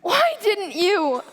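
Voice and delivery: high, anguished crying out; tearful anguish to God; tragic heroine sorrow. Acted.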